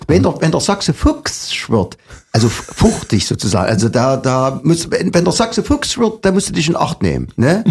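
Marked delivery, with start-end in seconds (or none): 0.4-2.0 broad Saxon, pitched up; Saxon dialect. Shown as a demonstration. Demonstrating Saxon dialect word. 5.1-6.2 broad Saxon, much higher pitch; Saxon dialect. Shown as a demonstration. Demonstrating Saxon dialect word.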